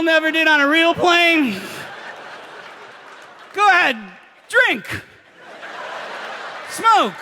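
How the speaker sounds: high, loud, frantic pilot yelling over the intercom; a crashing plane's pilot screaming at his passengers; panicked pilot. Acted. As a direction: shout it like a pilot losing control of the plane. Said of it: "that's the voice of death".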